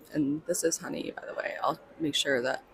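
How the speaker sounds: soft whisper aside. Real, not acted. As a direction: hush it so the neighbor can't hear. Introducing booth neighbor nearby quietly.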